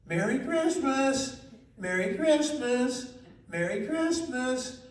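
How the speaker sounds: high, slow drilling voice; teaching the bird. Retold or imitated. Girl teaching the macaw its Christmas words, high. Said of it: "she'd say".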